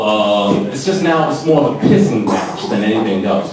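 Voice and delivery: ordinary talk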